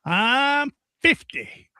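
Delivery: high, very slow "I'm fifty"; SNL "I'm fifty" bit. Retold or imitated. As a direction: stretch it out, pitched high, like the sketch lady. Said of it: "she kicks like she's doing karate".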